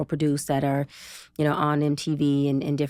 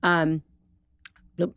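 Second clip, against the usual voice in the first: normal talking voice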